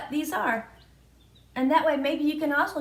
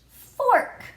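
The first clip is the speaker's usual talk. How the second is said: excited reveal; 12 semitones higher, 5 dB louder than usual. Acted. Teacher reveals the answer card.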